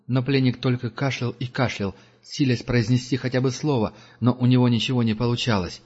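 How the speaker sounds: usual speaking voice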